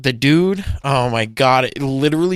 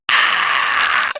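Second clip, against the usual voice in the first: whispered gasp. Retold or imitated. His reaction, voiceless.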